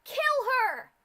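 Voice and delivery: very high, very loud murderous scream; furious scream. Acted. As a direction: scream the kill order. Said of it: "she screamed".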